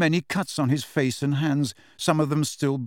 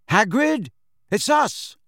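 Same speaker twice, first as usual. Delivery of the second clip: high, raised call; 10 semitones higher, 4 dB louder, slower than usual. A narrator's reading. Harry calls to Hagrid through the keyhole, calling out. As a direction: call urgently through a closed door. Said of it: "Harry called through the keyhole".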